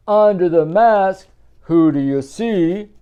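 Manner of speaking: loud, lilting picture-book read; reading to children. A narrator's reading. Parent reading a Halloween book aloud, sing-song. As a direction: read it out big for little ones. Storybook refrain.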